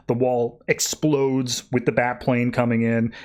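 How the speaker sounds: ordinary talk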